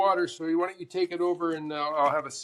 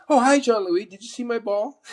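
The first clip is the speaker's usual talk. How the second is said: high, bright mock call from the wife; an imitated wife calls out to Jean-Louis cheerfully; wife's cheery call; 7 semitones higher, 5 dB louder than usual.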